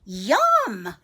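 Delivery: loud, drawn-out yum; little boy relishing. Acted. Boy enjoying his treat, relishing. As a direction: savor it like a happy child. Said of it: "he says".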